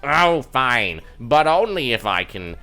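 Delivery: swooping, theatrical puppet voice; grudging scholar elf. Acted. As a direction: give in grudgingly, like a fussy stage scholar. Game master voices grudging elf.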